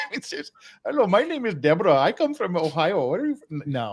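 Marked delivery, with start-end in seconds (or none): 0.8-3.6 put-on lilting Indian-accented agent greeting; Indian call-center accent. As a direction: imitate an Indian agent claiming to be American. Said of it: "I got the international part".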